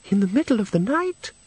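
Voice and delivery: high, tinkling, airy-headed question; breathless tinkle. Acted. Agnes questions the night visitor in her friend's breathless tinkle. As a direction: lift it high like someone away with the fairies. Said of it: "that breathless tinkle in the tone".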